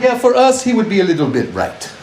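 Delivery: put-on high-pitched Icelander, accent drifting Russian; botched Icelandic accent. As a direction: deliver the punchline in a bad Icelandic accent, starting very high. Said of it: "I don't know why he sounds Russian".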